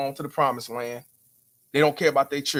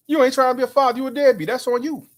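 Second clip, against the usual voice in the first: high mock-whiny accuser; 9 semitones higher than usual. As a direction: squeak out the accusation like a nagging critic. Mocking critics' accusation.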